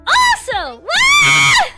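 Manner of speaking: loud high-pitched wah; high squeal. Real, not acted. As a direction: let out a cartoon squeal. Playful squeal.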